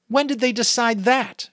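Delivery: put-on a boy's angry protest; angry question. A narrator's reading. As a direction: demand it, outraged at the unfairness. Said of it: "Jonas asked angrily".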